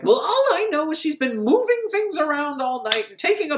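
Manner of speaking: high-pitched mock complaint; exasperated landlady. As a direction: gripe in a high, hammed-up voice. Acted landlady complaining to doctor.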